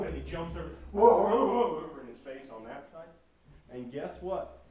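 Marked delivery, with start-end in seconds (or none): 0.9-1.8 very loud, high volley of woofs; imitated dog barking. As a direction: bark excitedly like a lunging dog. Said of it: "and Jerry goes".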